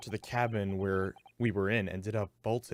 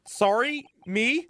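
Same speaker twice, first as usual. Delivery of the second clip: surprised; 8 dB louder, slower than usual. Acted. Hero shocked at being called devil.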